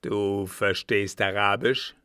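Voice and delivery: loud, suspicious question; menacing interrogation; growling old captain. A narrator's reading. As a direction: rumble it out as a threat.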